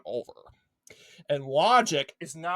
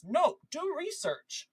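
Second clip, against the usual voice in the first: ordinary talk, nothing marked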